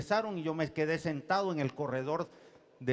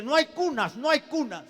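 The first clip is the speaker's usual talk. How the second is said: high, louder mock outburst; retold agitation; 11 semitones higher, 7 dB louder than usual. Doctor turning them away at emergency, imitated agitation. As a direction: imitate a rattled, just-woken doctor. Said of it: "sale alterado y dice".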